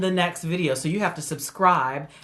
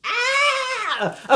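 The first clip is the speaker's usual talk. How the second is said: sudden squeal; 4 dB louder than usual. Real, not acted. Burst while posing for thumbnail.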